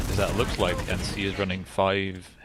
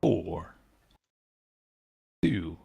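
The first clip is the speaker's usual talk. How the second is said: seductive voice; 2 semitones lower, much slower than usual. Pretend. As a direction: tease the countdown, ending in a whisper. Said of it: "should I do it in a very seductive voice".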